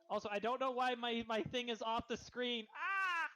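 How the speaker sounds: usual speaking voice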